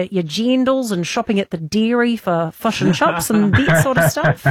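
lame put-on New Zealand accent; slow, hammed-up Kiwi twang. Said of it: "my really lame Kiwi accent".

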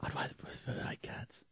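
whispered aside. Real, not acted. Host whispering a stubborn comeback to his co-host. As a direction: breathe it under your breath.

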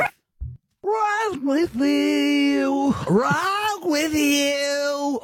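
stretched out very slowly and sung; elongated. Shown as a demonstration. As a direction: drag every sound out long. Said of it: "I just elongated it".

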